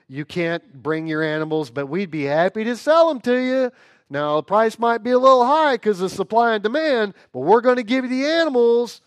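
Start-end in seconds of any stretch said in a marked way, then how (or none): 1.7-9.0 higher, louder huckster sales pitch; salesman pitch. Acted. Temple seller pitching animals to worshippers, hammed-up salesman voice. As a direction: hawk the animals like a pushy merchant.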